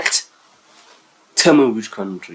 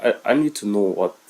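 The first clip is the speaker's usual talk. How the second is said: ordinary talk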